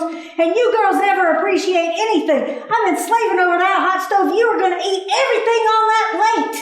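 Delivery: mother scolding her daughter over dinner. Retold or imitated. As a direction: lay down the law at the dinner table. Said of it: "This is me at nine years old".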